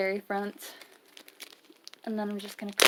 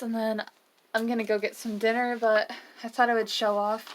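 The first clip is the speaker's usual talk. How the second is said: ordinary talk, nothing marked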